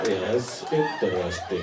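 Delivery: low, flat mock Finnish reply; deadpan Finn. Comic answering the book fair about her books in a low deadpan.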